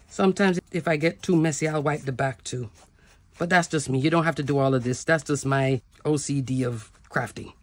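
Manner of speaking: ordinary talk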